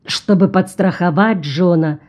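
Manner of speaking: ordinary delivery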